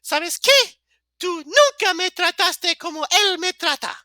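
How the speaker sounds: very high, hammed-up girl falsetto. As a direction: confront him in a put-on girly squeal. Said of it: "the girl voice, 'cause that was pretty good".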